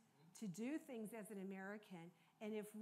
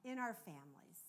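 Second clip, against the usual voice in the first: earnest prayer; 4 dB louder than usual. Real, not acted. Leads the congregation in prayer.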